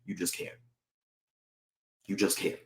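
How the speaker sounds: ordinary talk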